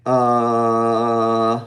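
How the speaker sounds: held neutral uh from the chest; neutral vowel. Shown as a demonstration. Teacher demonstrating the neutral vowel, held loud uh. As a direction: hold a loud relaxed uh. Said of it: "we're gonna say".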